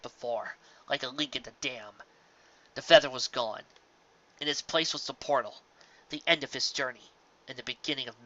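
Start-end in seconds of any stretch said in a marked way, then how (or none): none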